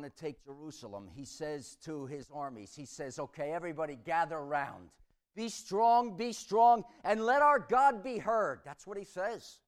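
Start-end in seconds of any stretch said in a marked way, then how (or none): 3.2-8.6 loud, higher rallying shout to the troops; rallying cry. Acted. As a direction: fire up the army before battle. King rallies his armies.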